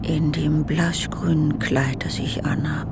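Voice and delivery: leise gehauchter innerer Monolog; geflüsterter Monolog. Acted. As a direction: ganz nah am Mikro flüstern, wie zu sich selbst. Held whispered monologue. (flüsternd zu sich selbst, leise und intim)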